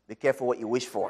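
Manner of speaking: ordinary delivery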